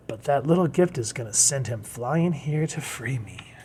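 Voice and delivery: whispered secret plan; whispered scheme. Acted. Poison Ivy plotting to lure Batman, whispered. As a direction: hush it like a villain plotting.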